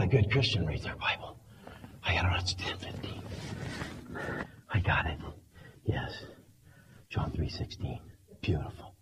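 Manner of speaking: low, fast whispered cramming; rushed whisper. Acted. Tired believer racing through his Bible, whispering fast. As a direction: hurry through the verses under your breath.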